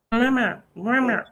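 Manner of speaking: imitating a talking cat; imitated cat sound. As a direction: mimic a cat's short meowed call. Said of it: "something like this?".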